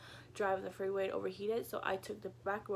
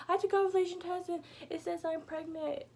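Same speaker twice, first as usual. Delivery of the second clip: high-pitched rushed explanation; breathless high retelling; excited; 6 semitones higher than usual. Retold or imitated. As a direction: tumble out the news, still high. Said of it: "I was like".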